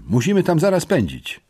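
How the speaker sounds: agitated call to action; irritated. A narrator's reading. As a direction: press them to get moving, annoyed. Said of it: "zdenerwowałem się".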